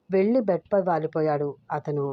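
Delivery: ordinary delivery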